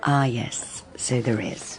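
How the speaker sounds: low, hushed whisper. Acted. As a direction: drop to a whisper, coolly. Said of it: "no whispering".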